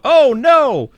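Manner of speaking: loud, high fake alarm; mock dismay. Recapping the twist for viewers, hammed-up dismay. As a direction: cry it out in pretend shock.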